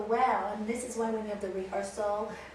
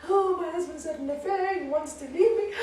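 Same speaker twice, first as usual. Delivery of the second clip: imitating crying; 6 semitones higher, 5 dB louder than usual. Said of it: "Oh, crying".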